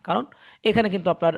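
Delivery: ordinary delivery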